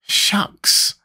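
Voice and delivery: put-on high boyish retort; indignant. A narrator's reading. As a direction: toss it back with mild indignation. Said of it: "returned Tip indignantly".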